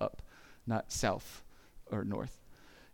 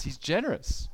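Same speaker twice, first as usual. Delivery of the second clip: normal talking voice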